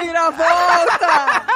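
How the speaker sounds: slow, drawn-out shouted twist; proclaiming the plot twist; dramatic announcement. Real, not acted.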